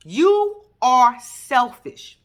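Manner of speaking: each word slow and punched; slow scolding. Real, not acted. Scolding the husband slowly, word by word. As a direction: spell it out slowly at him.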